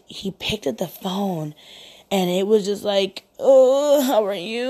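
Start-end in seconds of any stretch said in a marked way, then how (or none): 3.4-4.7 high, loud wailing imitation; mock crying moan. Woman imitating her date picking up the phone, mock crying. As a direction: moan it like a man pretending to sob. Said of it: "he sounded like he was crying and he was moaning".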